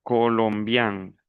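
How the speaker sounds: "colombian" very slow, ending on a nasal vowel. Shown as a demonstration. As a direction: stretch "colombian" and finish through the nose. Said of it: "las dos últimas son nasales". ("colombian" lento, con final nasal)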